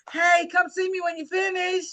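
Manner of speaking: a bright retold hello to shoppers; retold friendly call. Woman at the base calling shoppers over, mild friendly call.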